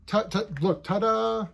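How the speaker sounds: playful, pointed presentation; showy ta-da. Pretend. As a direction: reveal it with a little flourish. Mock fanfare revealing the next page.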